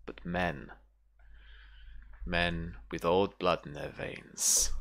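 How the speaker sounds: lightly voiced menacing brother. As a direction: let the threat sink quietly between pauses. Game master voices the hostile brother.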